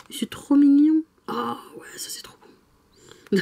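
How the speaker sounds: awed whisper over something cute; whispered delight. Real, not acted. Vlogger opening a cute manga, whispering in delight. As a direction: breathe it out in hushed delight.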